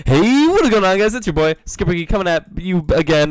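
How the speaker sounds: high, loud hype intro; YouTuber intro. Acted. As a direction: pump up the channel opening.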